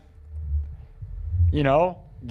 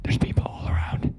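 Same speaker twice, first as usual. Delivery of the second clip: horror-movie whisper; 6 dB quieter than usual. Pretend. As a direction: whisper like you're hiding in a horror film. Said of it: "sound like I'm in a horror movie".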